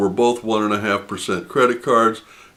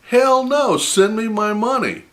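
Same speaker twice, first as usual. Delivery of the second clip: indignant outburst; 12 semitones higher than usual. Retold or imitated. Indignant retort to "forfeit" offer.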